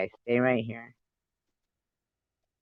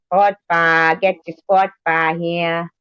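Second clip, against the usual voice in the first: high, loud Boston vendor call; hammed-up Boston accent, calling out; 5 semitones higher, 4 dB louder, slower than usual. Showing viewers her attempt at Boston, in a Boston accent. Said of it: "I can't do Boston. I do".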